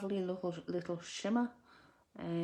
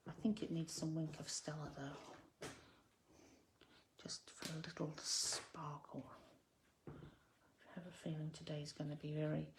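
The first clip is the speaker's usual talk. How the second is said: softer, half to yourself; muttering to herself; 6 dB quieter than usual. Real, not acted. Crafter thinking aloud about adding sparkle, muttering. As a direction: mutter it under your breath while working. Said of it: "muttering to myself".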